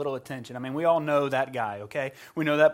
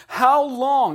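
loud, high cry to God; David; 9 semitones higher, 11 dB louder than usual. Retold or imitated. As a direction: cry it out as a pleading lament. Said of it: "That's a cry that we're familiar with".

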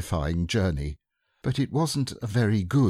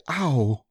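landing "ow"; 6 semitones higher than usual. A narrator's reading. Piglet coming down in Kanga's pocket, falling "ow". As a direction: let the "ow" drop as if landing. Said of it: "as he came down, he said".

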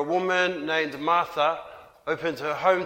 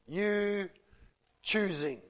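hushed emphasis; 8 dB quieter, slower than usual. Real, not acted. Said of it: "repeated stressed phrase".

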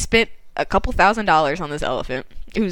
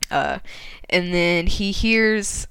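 plain reading voice

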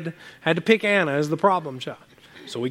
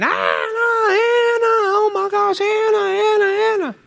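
high, loud panicked calling of a name; frantic screaming; 3 dB louder than usual. Retold or imitated. Mom, searching for missing Anna, screaming her name. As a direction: shriek the name like a mother who lost her child. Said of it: "I hear Mom come out screaming".

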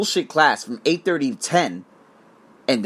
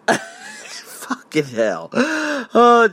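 laughing groan; 7 semitones higher, much slower than usual. Real, not acted. Music interrupts, he reacts.

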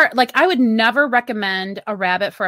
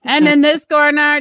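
boxing announcer; 4 semitones higher, 6 dB louder than usual. Pretend. Boxing announcer introduces champion.

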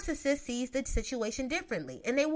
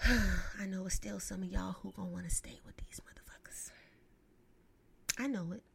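sighing whisper; 4 semitones lower, 7 dB quieter than usual. Real, not acted. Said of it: "sighing resignation".

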